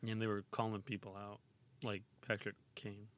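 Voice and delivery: ordinary talk, nothing marked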